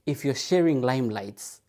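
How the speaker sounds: ordinary delivery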